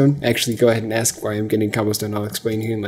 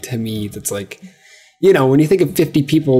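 ordinary delivery

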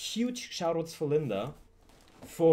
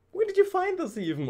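excited squeal; 4 dB louder than usual. Real, not acted. Excited unboxing repeat.

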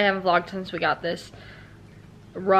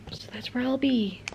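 whispering; 3 semitones higher, 8 dB quieter than usual. Real, not acted. Sudden whisper.

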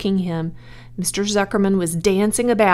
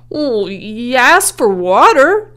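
drawn-out, raised-pitch excuse; meek reply; 5 semitones higher, 7 dB louder, slower than usual. A narrator's reading. Helper answers the soaked owner, meek drawn-out excuse. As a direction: offer a slow, meek defence, still projected. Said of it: "said Lurvy meekly".

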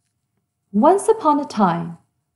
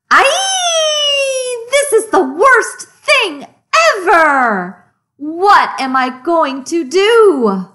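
high wailing cry of despair, louder; hammed-up despair; 8 semitones higher, 4 dB louder, slower than usual. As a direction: wail at the ruined page. Stain gets worse.

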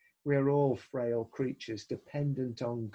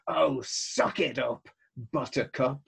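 mock someone who complains, stretching the words; dismissive scolding; 3 dB louder than usual. Mocking dismissive retort to complainers.

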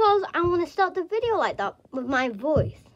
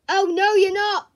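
defiant; 4 semitones higher, 9 dB louder than usual. Acted. Defiant reply.

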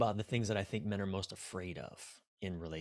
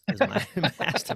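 laughing mid-word at his own joke; 8 semitones higher, 6 dB louder than usual. Real, not acted. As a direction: break into a chuckle on the word.

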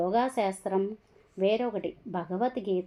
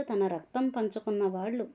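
ordinary delivery, nothing marked

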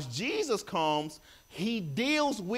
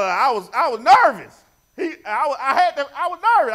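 laughing; 6 semitones higher, 12 dB louder, faster than usual. Real, not acted. Retelling nervousness, laughing.

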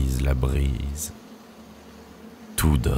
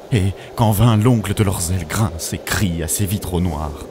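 raised-pitch dramatic supplication; fervent plea; 4 semitones higher than usual. Acted. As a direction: implore the Prophet to save you from demons. Desperate prayer to the Prophet. (supplication fervente et théâtrale)

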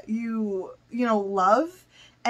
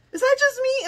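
"Is that just me?" much higher, disbelieving; amazed squeak; 13 semitones higher, faster than usual. Real, not acted. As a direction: pitch "Is that just me?" way up in wonder. Asking again, amazed.

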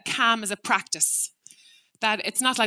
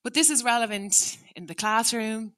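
ordinary delivery

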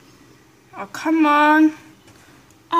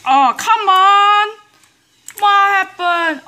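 frustrated outburst; 7 semitones higher, 6 dB louder, slower than usual. Real, not acted. Gamer loses in platform game.

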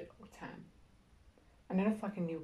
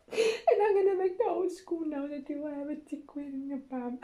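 taunt; 8 semitones higher, 5 dB louder than usual. Acted. Taunting principal.

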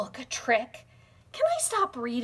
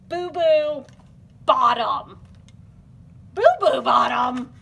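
silly voice; 9 dB louder than usual. Acted. Silly hippo name.

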